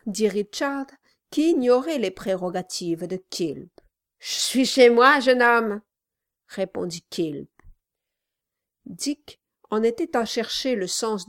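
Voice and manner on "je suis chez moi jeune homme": gruff gravelly dwarf voice. Acted. Dwarf retort to Richard.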